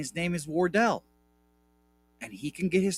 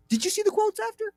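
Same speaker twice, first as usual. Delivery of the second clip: gleeful shriek; faster than usual. Real, not acted. Gloating repeat.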